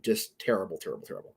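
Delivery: disgusted headshake voice, fading out. Real, not acted. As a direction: let "terrible" trail away into breath.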